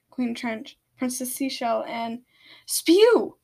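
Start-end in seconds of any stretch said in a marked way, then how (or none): 2.6-3.4 sharp, high shout of a name. A narrator's reading. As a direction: yell the name out loud. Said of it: "Paragraph shouted at him".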